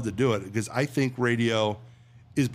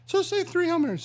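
scolding nun. Retold or imitated. Nun caddy scolding the priest, high falsetto. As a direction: squeak out the reproach like a prim nun. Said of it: "the sister goes".

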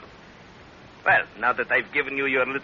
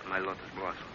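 soft menacing sneer; 7 semitones lower, 14 dB quieter than usual. Acted.

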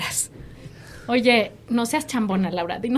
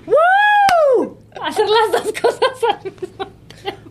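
señora woo; 10 semitones higher, slower than usual. Shown as a demonstration. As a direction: let out a fan's squeal. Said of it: "O decir".

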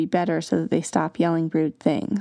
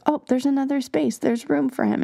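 higher, bright discovery voice; excited kids; 6 semitones higher than usual. Retold or imitated. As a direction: suddenly realize there's room. Kids excitedly finding space.

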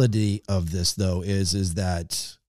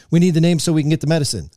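fast, higher and louder, imitating staff; retold urgency; 6 semitones higher, 5 dB louder, much faster than usual. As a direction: rush it out like pressed hospital staff. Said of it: "under a sense of urgency".

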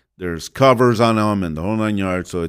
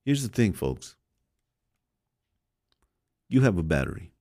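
solemn sermon; 3 semitones lower, 7 dB quieter than usual. Acted. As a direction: let each warning sink in quietly.

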